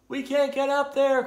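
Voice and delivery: high, loud shout upward; crying out. A narrator's reading. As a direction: call up in storybook dismay. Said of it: "cried the turtles".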